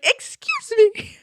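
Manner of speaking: very high, slow, drawn-out "excuse me"; a hammed-up outraged gasp of a line; mock indignant. I wanted to beat this man.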